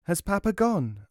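put-on high little boy's question; child asking. A narrator's reading. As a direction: ask cautiously as a small child. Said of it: "asked the child".

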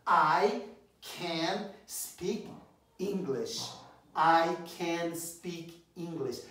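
very slow, each word laid out; slow model for repeating. Shown as a demonstration. Teacher modelling slowly for students to repeat. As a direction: say it slowly so students can copy. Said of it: "Repete comigo".

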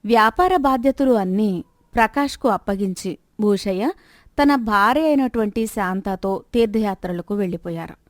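ordinary delivery, nothing marked

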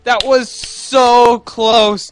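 high, drawn-out excited cry; excited disbelief. Real, not acted. Player after a one-second win, excited disbelief. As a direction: stretch it out in amazed relief. Narrow escape in the game.

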